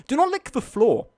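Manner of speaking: high, loud mock warning with NOT stressed; warning cry. Shown as a demonstration. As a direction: yell the rule at the viewers.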